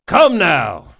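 shouted over the crowd; loud call. A narrator's reading. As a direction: call the crew to order. Quieting cheering crew.

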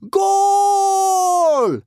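very high, loud, drawn-out cheer; crowing team celebrating a score; merry cry. A narrator's reading. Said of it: "merrily cried out".

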